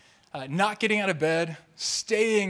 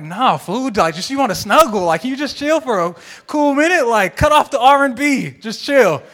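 much louder, higher comic outburst; exasperated mock scolding; 7 semitones higher, 13 dB louder than usual. Wife telling husband to just snuggle, loud mock scolding. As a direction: shout him down as the fed-up wife.

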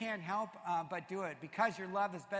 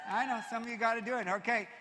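usual speaking voice

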